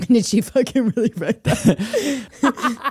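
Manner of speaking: high, slow, laughing through the words; laughing disbelief. Retold or imitated. Incredulous quote while laughing.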